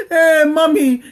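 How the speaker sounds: mock child calling for attention; childlike calling. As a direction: call out like a needy child. Acting husband calling like a child.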